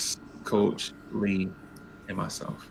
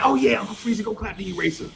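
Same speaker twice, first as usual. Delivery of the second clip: teasing teacher; 9 semitones higher, faster than usual. Retold or imitated. Teacher voiced mocking.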